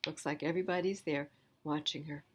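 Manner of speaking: usual speaking voice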